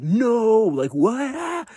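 very high, squealed fake surprise; hammed-up overjoyed shock, pitch shot right up; mock excited disbelief. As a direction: act amazed by a present. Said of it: "I'm just so excited".

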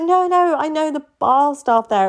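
high urgent protest; mock insistent. Girl steering her date to a bar she can enter, high and urgent. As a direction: rush to steer him, anxious. Pleading to change venue.

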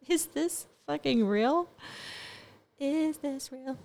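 drawn-out in stunned disbelief. Retold or imitated. Voicing her stunned thoughts about corona weirdness, disbelieving.

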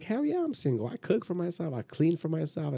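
high-pitched start, settling as the list goes; playful boasting. Retold or imitated. Retells boasting that he is single.

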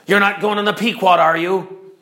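rough, raspy stranger calling out. Acted. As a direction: bark it out in a raspy voice. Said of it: "with a raspy voice and says".

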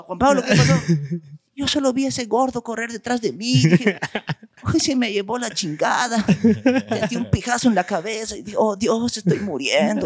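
high, theatrical dubbed-show testimony; little brother mocking the dubbed Spanish accent of Rescate 911. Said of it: "se nos pegaba el acento".

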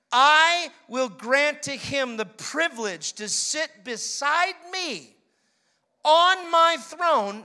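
loud, slow, emphatic sermon read; a slow, booming scripture read; preaching scripture. Acted. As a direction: proclaim each phrase to the congregation.

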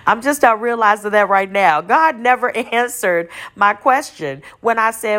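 amused at a sudden realization; real laughter while telling it; laughing realization. Real, not acted.

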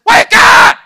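high, loud, sharp Wake up!; commanding shout. Shown as a demonstration. Pastor shouts the Greek command at the congregation, very loud. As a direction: bark it like an alarm going off. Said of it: "It's a command".

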